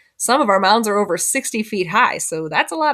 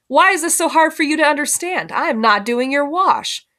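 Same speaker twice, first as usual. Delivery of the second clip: talking down; 7 semitones higher than usual. A narrator's reading. Renter refuses Mama's washing, talking down. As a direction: explain it as if to a small child. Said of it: "like she was talking to a small child".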